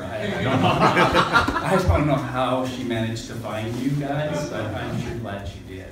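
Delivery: talking through real laughter; laughing. Real, not acted. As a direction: laugh shyly while explaining. Laughs about his wife finding them.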